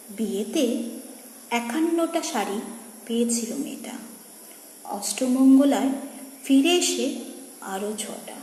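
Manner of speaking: soft refrain, fading away at the end; hushed poem recitation. Acted. Reciter closing on the widow's saris, hushed recitation. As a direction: let the verse sink almost to a whisper.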